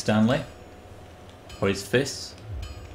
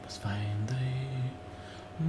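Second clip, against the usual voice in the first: whisper; 2 semitones higher, 10 dB quieter, much slower than usual. Real, not acted. Mutters goal to himself.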